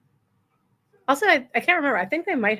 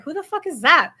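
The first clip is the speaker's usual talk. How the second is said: shocked outburst; 8 semitones higher, 5 dB louder than usual. Retold or imitated. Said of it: "I'm like".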